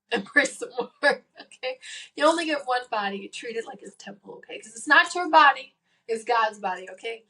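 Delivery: normal talking voice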